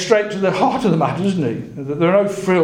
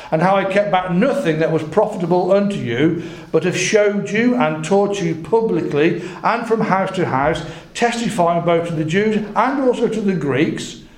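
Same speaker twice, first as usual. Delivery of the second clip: higher-pitched, emphatic Bible reading; scripture reading; 4 semitones higher than usual. A narrator's reading. Preacher reads Paul's testimony aloud, emphatic scripture reading. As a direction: read the verse out with weight on key words.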